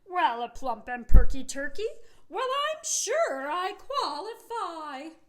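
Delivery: loud, proud turkey voice; hearty turkey cry. Acted. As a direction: puff up and crow it like a cocky turkey. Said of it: "he gave out a hearty cry".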